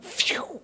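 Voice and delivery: soft voiceless puff of air; exhaled phew. Retold or imitated. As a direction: blow out a quiet, airy phew. Imitating exhaling in tired moments.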